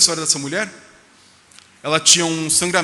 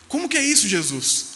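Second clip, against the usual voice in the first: high-pitched, curious asking of Jesus; eager question; 6 semitones higher than usual. Pretend. Believer asks Jesus again how it works, high eager question. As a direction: ask Jesus like an eager disciple.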